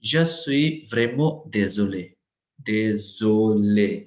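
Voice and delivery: Je suis vraiment désolé spelled out very slowly; slow model sentence. Shown as a demonstration. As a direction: dictate it word by word for the class. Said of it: "ध्यान से सुनो". (धीरे-धीरे, एक-एक शब्द साफ़)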